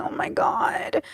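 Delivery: slow, soft, voiceless whimper; mock ugly crying. Streamer retelling reaction to a sweet story, mock tearful. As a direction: imitate being overcome with tears. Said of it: "I was ugly crying".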